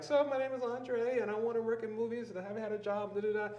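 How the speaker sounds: mock your own eager pitch to strangers; acted elevator pitch. Acts out his hurried elevator pitch.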